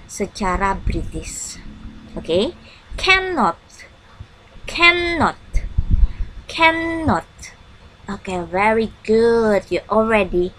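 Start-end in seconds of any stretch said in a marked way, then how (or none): 3.0-7.3 cannot started high and falling; model word for repeating. Shown as a demonstration. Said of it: "I want you to repeat after me".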